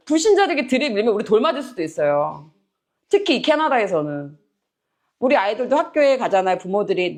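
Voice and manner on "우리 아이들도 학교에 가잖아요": usual speaking voice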